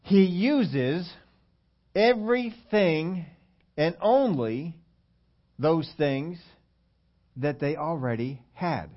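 slow, weighty teaching; slow emphatic. Shown as a demonstration. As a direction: drag out each word for emphasis. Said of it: "you gotta learn this thing".

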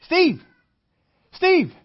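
loud, high call across a store. Pretend. Neighbor spots you in Walmart, calls out loud and high. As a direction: shout the name to get his attention.

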